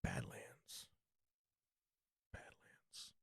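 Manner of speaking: whispered Badlands. Streamer whispering the channel name. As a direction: breathe the name with no voice.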